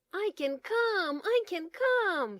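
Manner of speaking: high, loud, slow joyful cheer; excited shout. Acted. Bear calling Franklin with big news, joyfully shouting. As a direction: shout it out with pure delight. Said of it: "he shouted".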